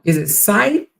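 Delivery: tentative, rising "scythe"; checking a pronunciation. Shown as a demonstration. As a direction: ask with "scythe" lifting at the end. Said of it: "Anyway, let me know".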